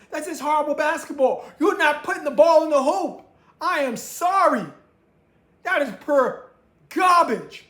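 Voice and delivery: sports fan venting, loud and high; disgusted rant. Real, not acted. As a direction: tear into the garbage play. Ranting about bad basketball.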